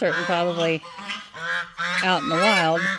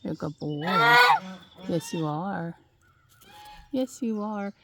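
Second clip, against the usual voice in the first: cooing; 2 semitones higher, 4 dB louder than usual. Real, not acted. Cooing to pet goose.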